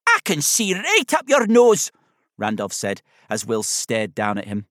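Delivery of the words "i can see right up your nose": high, loud Scottish teddy bear; high-pitched Scottish character voice; Scottish accent. A narrator's reading. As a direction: agree brightly in a Scottish accent. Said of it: "a voice with a soft Scottish accent".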